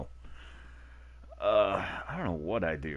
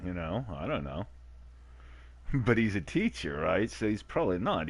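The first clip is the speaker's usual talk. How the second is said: ordinary delivery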